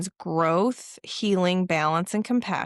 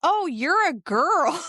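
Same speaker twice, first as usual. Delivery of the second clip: mock surprise; 9 semitones higher, 4 dB louder, slower than usual. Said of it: "and she goes".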